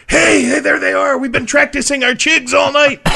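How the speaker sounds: very high, loud Irish brogue; cheery drunk Irish greeting; drunk Irishman. Retold or imitated. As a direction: hail them like a drunk pal at the bar. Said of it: "who's there but Seamus".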